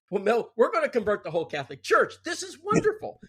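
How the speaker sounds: high, eager and thrilled, wonderful rising way up; naive excitement. Retold or imitated. Teen telling his friend about the Monsignor, thrilled and high-pitched. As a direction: replay your teenage self bursting with big plans.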